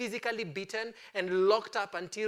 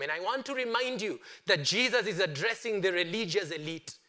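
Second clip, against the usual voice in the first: usual speaking voice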